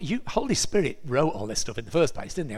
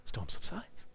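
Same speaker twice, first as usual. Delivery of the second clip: whispered, much softer; a storyteller's whisper; hushed whisper; 3 semitones lower, 11 dB quieter, slower than usual. Acted. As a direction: let it drop to a breathy hush as the sea goes still. Hushed reveal of calm sea.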